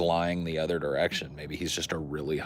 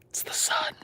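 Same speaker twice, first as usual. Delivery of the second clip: very high, whispered squeak; squeaky whisper. Shown as a demonstration. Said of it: "repeated whisper".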